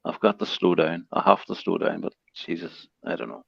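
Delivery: quiet, defeated self-rebuke; resigned trailing off. Real, not acted. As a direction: give up on it under your breath. Resigned after being told too fast.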